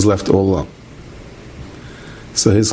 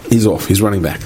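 ordinary talk, nothing marked

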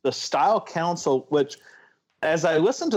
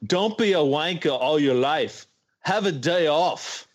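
put-on British accent; 2 semitones higher than usual. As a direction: toss it off in a mild London accent. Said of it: "Wow, that accent".

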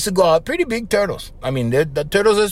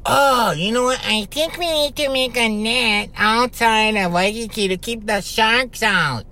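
higher put-on voice, slowing at the end; mocking imitation of people; 5 semitones higher than usual. Host mocks people reacting after a shark attack, put-on voice. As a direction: mimic people having a belated bright idea. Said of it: "people are gonna go".

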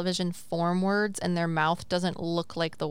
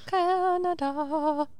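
high, very slow stretched vowels; drawn-out singsong; 9 semitones higher, much slower than usual. Pretend. Echoing co-host's wish to be Canadian, drawn-out singsong. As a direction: stretch every syllable of it up high.

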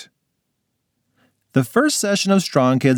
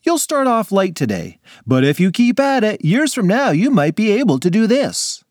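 lightly voiced hearty coach; booming friendly trainer; gym instructor; 9 semitones higher than usual.

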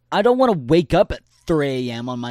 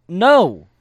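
higher and louder, flat-out no; emphatic refusal; 6 semitones higher, 12 dB louder than usual. Real, not acted. Reacting in disgust to the sibling idea, loud emphatic no. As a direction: shut the idea down hard.

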